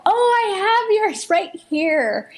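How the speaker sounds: bright, high, sugary host voice; fake cheery surprise. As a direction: act delighted to see them. Acting surprised greeting to neighbor.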